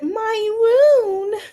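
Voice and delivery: hammed-up wail of "my wound"; mock whiny quote. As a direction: imitate her as a pitiful wailer. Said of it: "What she said".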